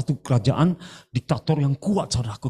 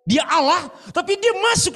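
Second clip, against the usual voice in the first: much higher, laughing burst; excited laughing exclamation. Real, not acted. (seruan gembira sambil tertawa)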